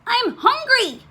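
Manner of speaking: high-pitched storybook wail; crying out. A narrator's reading. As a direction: cry out in protest. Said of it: "cried Cyril".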